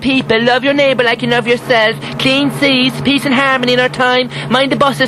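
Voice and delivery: high, rising, eager farewell; radio host gushing a high, eager sign-off to listeners; exuberant sign-off. Acted. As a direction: sign off the show with gushing cheer. Host's big on-air sign-off.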